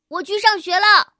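bright, loud goodbye call; cheerful call. Acted. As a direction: sing it out on the way to school. Kangaroo calls out leaving.

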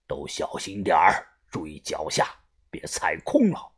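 low, soft boss voice cautioning the crew; hushed warning. Acted. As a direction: drop deep and quiet to warn them. Boss warns crew in the tomb.

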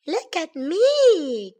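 high, drawn-out cub's call; playful cry. A narrator's reading. Baby bear showing off by the stream, high call. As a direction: cry out slowly for attention. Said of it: "cried Baby Bear".

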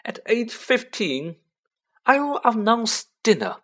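high prim housewife voice; lightly voiced fussy aunt; Aunt Petunia voice. A narrator's reading. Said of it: "said Aunt Petunia".

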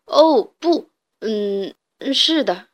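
stumbling, loud then hesitant answer; flustered stammer. Acted. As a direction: caught off guard and fumbling. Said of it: "语无伦次地回答". (语无伦次地回答)

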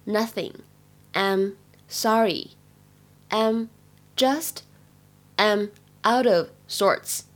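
slow teaching read with pauses between chunks; careful, drawn-out model reading for learners; slow word by word. Shown as a demonstration. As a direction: say it slowly, one piece at a time.

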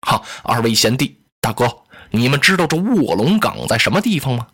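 a measured, polite sworn elder brother; Liu Bei in character. Acted.

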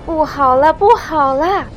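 high, loud cry of alarm; frantic warning; panicked shout. A narrator's reading. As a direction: shout the bad news in panic. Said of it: "惊慌地大喊".

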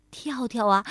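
ordinary delivery, nothing marked

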